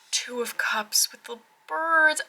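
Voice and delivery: slow whispered reveal; hushed whisper. Real, not acted. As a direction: breathe the card name.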